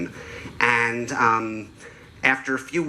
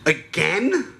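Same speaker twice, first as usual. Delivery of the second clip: high imitated four-year-old in disbelief; mock helium-kid surprise; little girl voice; 7 semitones higher than usual. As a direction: squeak it upward like an incredulous child. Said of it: "that adorable little four-year-old girl helium balloon voice".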